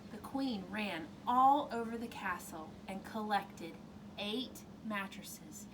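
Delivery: ordinary delivery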